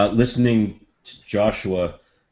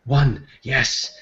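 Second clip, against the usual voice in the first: higher, slow, entranced; dazed wonder, drawn out; 6 semitones higher, much slower than usual. Acted. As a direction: speak as if caught in a revelation. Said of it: "almost in a daze, Malam would look".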